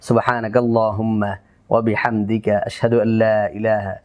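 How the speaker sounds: low, even Arabic du'a; closing prayer recitation. Real, not acted. (নিচু স্বরে দোয়া পাঠ)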